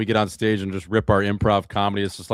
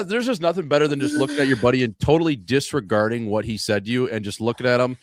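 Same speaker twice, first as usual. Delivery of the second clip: normal talking voice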